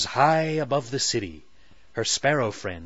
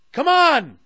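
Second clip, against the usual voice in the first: high, loud call to a friend; 11 dB louder than usual. Acted. Sally calling her sparrow friend Ato, high and loud. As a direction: shout to get the bird moving. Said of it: "Sally called to Ato".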